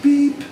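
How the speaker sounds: high mock buzzer; buzzer beep. Host imitates buzzer.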